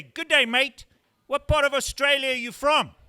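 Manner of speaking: cheesy fake Australian accent; bad Australian accent imitation. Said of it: "and a bad accent imitation".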